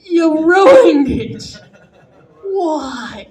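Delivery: loud, drawn-out cry of betrayal; anguished yell. Retold or imitated. Viewer crying out at Bob Ross, loud and anguished. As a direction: wail it like your painting was wrecked. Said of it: "And you're going".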